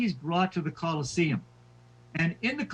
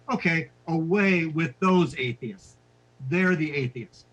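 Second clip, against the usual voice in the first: witty retort. Retold or imitated. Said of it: "Polycarp is so witty".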